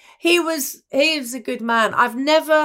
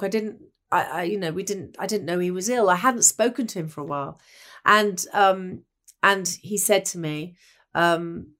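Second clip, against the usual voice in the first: usual speaking voice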